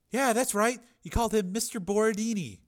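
put-on very high, loud pleading girl; terrified girl. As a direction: shriek in terror. Frightened girl.

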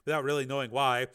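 usual speaking voice

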